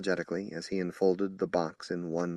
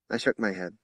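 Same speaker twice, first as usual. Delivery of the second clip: plain reading voice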